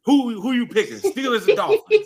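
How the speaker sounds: high, loud demand, near laughing; loud high-pitched badgering; exasperated pressing. Real, not acted. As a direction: push hard for an answer. Pressing co-host for his pick.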